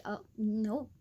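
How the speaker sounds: ordinary delivery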